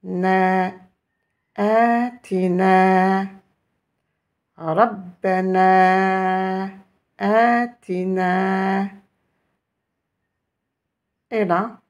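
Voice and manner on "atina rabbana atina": atina and rabbana with long held vowels, very slow; prolonged vowels. Shown as a demonstration. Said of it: "une prolongation du son E".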